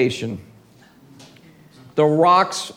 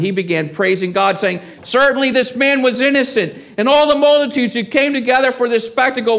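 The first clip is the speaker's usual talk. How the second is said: raised, fast reading of the Gospel text; scripture reading; 7 semitones higher than usual. A narrator's reading. Preacher reading Luke aloud to his church, raised and quick. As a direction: read the passage briskly to the congregation.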